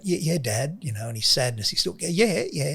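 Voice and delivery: mock upbeat boy's yeahs; forced upbeat. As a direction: sound cheerful though you're sad. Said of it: "he's trying to be upbeat".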